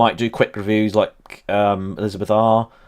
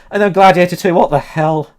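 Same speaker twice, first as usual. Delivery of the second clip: ordinary talk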